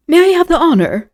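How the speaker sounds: high, gallant formal offer; ceremonious. A narrator's reading. Lord offering his arm to the Comtesse, ceremonious. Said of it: "he added ceremoniously".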